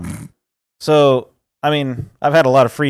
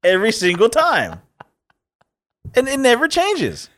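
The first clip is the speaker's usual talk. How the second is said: exasperated; 7 semitones higher than usual. Acted. Exasperated repeat of the routine.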